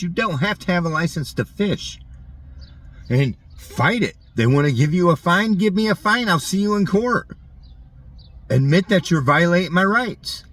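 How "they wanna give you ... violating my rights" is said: defiant outburst. Real, not acted. Defiant rant against fines.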